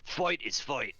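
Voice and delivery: a hint of an orc making his point; mock orc declaration; big orc. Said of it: "then it's a big orc".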